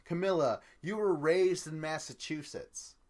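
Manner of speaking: yelled taunt across the pool; retold yell. Amanda taunting Camilla across the pool, yelling. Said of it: "She's yelling across".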